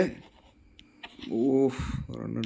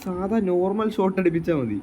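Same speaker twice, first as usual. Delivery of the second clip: excited; 5 semitones higher than usual. Real, not acted. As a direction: gush about the goal to viewers. Excited after scoring a goal. (ഗോളിന് ശേഷം ആവേശം)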